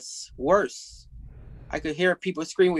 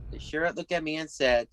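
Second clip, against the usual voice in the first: ordinary delivery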